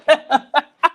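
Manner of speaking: high, loud burst of laughter; cracking up. Real, not acted. Storyteller breaking up at his own story, real laughter. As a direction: lose it laughing.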